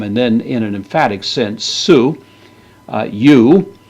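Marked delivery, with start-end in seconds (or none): 1.6-2.2 su stressed high and loud; emphatic su. Shown as a demonstration. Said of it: "Emphasis on the word you". 2.9-3.8 you said loud and high; emphatic you. Shown as a demonstration. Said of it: "Emphasis on the word you".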